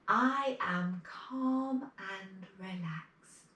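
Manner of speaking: slow, deliberate affirmations; calm model affirmations; affirmation demo. Shown as a demonstration. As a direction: say each one slowly for kids to repeat. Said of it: "I'll say it first and then you say it".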